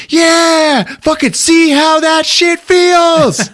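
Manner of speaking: imitating a very high, loud, vindicated whoop; gloating cheer. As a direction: holler it in glee, stretching the end. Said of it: "jumping up and down and cheering".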